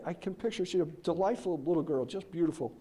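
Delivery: ordinary delivery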